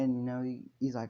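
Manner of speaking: ordinary delivery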